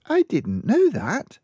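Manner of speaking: put-on child explaining her mother's habits; small girl. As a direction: speak like a matter-of-fact little girl. Girl amazed.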